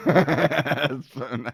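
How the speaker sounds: slow words broken by laughter; laughing. Real, not acted. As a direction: echo the joke while cracking up. Laughing while echoing the joke.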